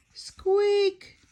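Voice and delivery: high squeak; squeaky shoe noise. Acted. Narrator voicing the shoe with a high squeak.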